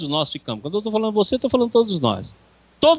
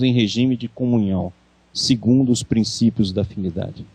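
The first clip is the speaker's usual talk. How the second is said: quoted reading; 8 semitones lower than usual. Acted. Reads a quoted passage.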